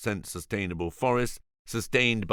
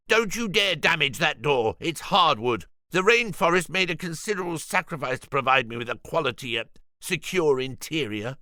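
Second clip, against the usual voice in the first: higher, fast indignant scolding; scolding Julian; 8 semitones higher than usual. Acted. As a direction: warn them off the precious door. Julian scolding men at the door.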